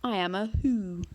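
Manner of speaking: weighty creature voice, proud; monster voice. A narrator's reading. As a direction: declare it like an ancient being. Monster voice held.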